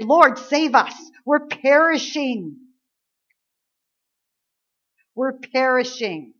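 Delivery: loud, urgent plea, slowing on perishing; desperate plea. Retold or imitated. Disciples cry to Jesus in the storm, desperate plea. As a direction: beg for rescue as the boat sinks. Disciples panic in sinking boat.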